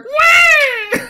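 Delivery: high, loud parrot screech; bird squawk. Retold or imitated. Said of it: "And the bird's like".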